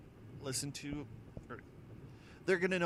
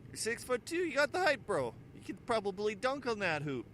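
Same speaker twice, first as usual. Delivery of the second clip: insistent stranger; 8 semitones higher than usual. Retold or imitated. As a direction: push the pitch up, disbelieving. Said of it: "He goes".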